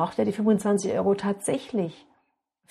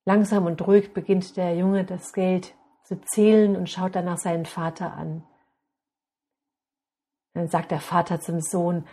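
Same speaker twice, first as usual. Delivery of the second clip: ordinary delivery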